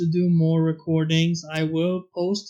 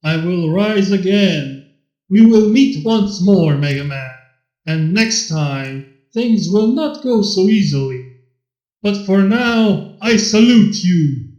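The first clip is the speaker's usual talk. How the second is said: dramatic dying villain; 6 dB louder than usual. Acted. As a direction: savor your own theatrical fall. Elecman's last vow.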